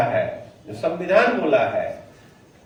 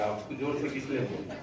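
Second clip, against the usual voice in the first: soft tired mumble with a laugh; mock fading energy; 5 semitones lower, 9 dB quieter than usual. Trainer mocking the room's tired voices, softly. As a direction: let the energy drain away, joking. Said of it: "ऐसा लग रहा अब तो हो गया".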